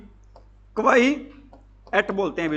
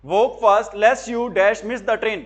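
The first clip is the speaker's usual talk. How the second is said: slow dictation; much slower than usual. Shown as a demonstration. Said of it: "dictating a sentence to students".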